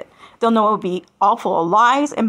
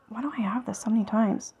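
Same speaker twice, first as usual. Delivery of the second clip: whispered aside; 9 dB quieter than usual. Real, not acted. Muttering to herself mid-read.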